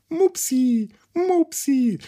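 put-on high cooing baby talk; cutesy pet voice. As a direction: coo the name like calling a pet. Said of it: "Und dann dachte ich".

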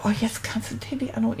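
fast excited whisper; whispered thought. Retold or imitated. Homesick boy secretly thinks of calling Tilly, whispering.

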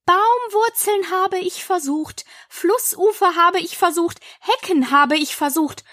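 high, lamenting bird voice; aggrieved pigeon. A narrator's reading. Pigeon lists hiding places tried, aggrieved. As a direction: list each failed attempt plaintively.

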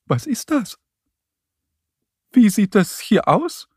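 high, worried little mole voice; acted anxious high questioning. A narrator's reading. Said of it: "fragt er ängstlich".

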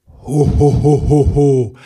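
loud booming belly laugh; jolly, loud ho-ho-ho; Santa's ho-ho-ho. Acted. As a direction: boom out a hearty Santa laugh. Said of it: "das Geräusch ging so".

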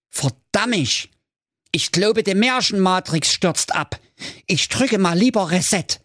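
high-pitched alarmed character voice; comic panic. Acted. Jakob fears the matrix is crashing, high mock panic. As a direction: fret in a high voice as things fail. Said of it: "brach inzwischen helle Panik aus".

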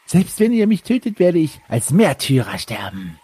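wild unhinged ranting. Acted. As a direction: cackle madly, then threaten. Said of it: "aber dann kommt ein irres Lachen über seine Lippen".

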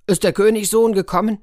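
high and loud storybook giant; giant's booming question. Acted. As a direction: boom it out as the giant coming home. Giant stomps home demanding the prince.

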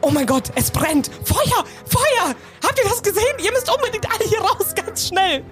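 high frantic yelling about a fire; panicked shouting. Acted. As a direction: scream a false fire alarm at the crowd. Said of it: "ich fange einfach zu schreien".

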